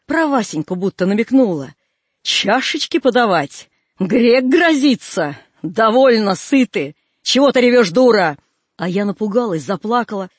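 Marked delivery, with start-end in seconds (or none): 2.2-8.4 strange angry voice. Acted. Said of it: "будто не своим голосом".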